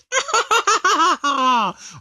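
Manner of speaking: high mocking villain laugh; Babidi laugh. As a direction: cackle like a gloating wizard. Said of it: "Babidi just straight up laughs".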